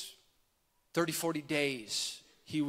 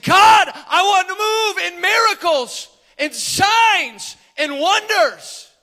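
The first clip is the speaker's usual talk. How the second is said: high, loud cry to God; top-of-lungs shout; 12 semitones higher, 14 dB louder, slower than usual. Retold or imitated. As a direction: shout the plea with all your lungs, stretching the last words. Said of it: "at the top of his lungs, he shouted".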